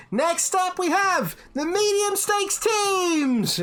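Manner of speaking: high, slow, drawn-out winner announcement; announcer call. Acted. Innkeeper calling out the winners to the pub, booming announcer. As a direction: stretch it out like a ring announcer. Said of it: "she's like".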